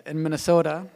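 normal talking voice

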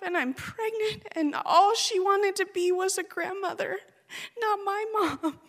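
high, breaking voice close to crying; real grief retelling her mother's death; tearful. Real, not acted. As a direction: speak through tears. Grieving recollection of her mother.

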